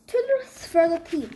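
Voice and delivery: usual speaking voice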